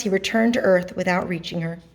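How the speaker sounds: ordinary delivery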